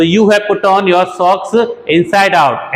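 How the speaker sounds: slow, deliberate teacher read; slow example sentence for learners; slow model sentence. Shown as a demonstration. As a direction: dictate each word slowly. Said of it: "तो".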